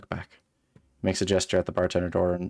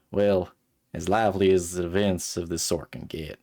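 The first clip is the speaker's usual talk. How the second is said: put-on Southern accent. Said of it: "Like a really Southern voice".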